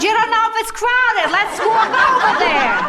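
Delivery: high, loud laughing push; wife laughs him into walking over; laughing insistence. Retold or imitated. High and loud, wife teasing her husband while laughing.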